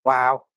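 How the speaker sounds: strained grunt under load; effort grunt. Real, not acted. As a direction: push it out with a grunt. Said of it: "I'm sorry for all the grunting".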